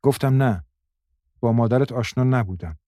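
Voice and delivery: lifted voice to be heard. A narrator's reading. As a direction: speak up so a half-deaf girl hears. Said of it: "مریم صدایش را بلند کرد".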